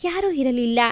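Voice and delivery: plain reading voice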